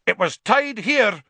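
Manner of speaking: high, loud, furious protest. A narrator's reading. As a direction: rage at the loss like a thwarted executioner. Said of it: "said the executioner furiously".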